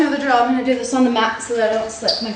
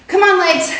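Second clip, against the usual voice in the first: cheering; 7 semitones higher, 5 dB louder than usual. Real, not acted. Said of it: "Cheer for your legs".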